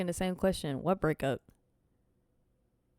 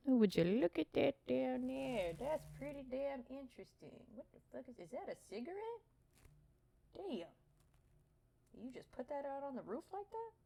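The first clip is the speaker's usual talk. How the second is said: mock squeaky voice; 7 semitones higher, 8 dB quieter than usual. Mock voice commenting on cigarette.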